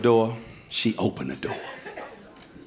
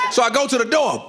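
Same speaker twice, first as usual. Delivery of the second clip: ordinary talk, nothing marked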